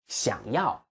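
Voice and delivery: 想要 with the second syllable 要 held and stressed; correct stress on 想要. Shown as a demonstration. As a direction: model 想要 with stress on 要. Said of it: "it should be想要".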